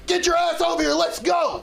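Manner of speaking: put-on high-pitched shouted order to a kid; angry yelling. Angry father pulls his son from the team, yelling. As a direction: imitate a furious dad ordering his son over. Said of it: "the man that was cursing and yelling".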